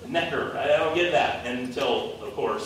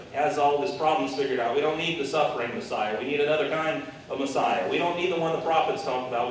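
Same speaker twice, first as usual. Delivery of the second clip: usual speaking voice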